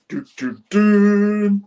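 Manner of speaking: two short doos, then a loud high held one; dramatic sting imitation. Storyteller marking the big reveal, vocal dun-dun-dun sting. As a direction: sing a mock suspense fanfare.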